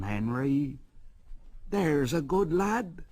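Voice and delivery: lightly voiced driver, firm then coaxing. A narrator's reading. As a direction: driver coaxes Henry gently. Driver coaxes Henry.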